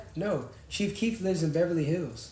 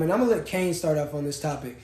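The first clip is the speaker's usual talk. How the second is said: normal talking voice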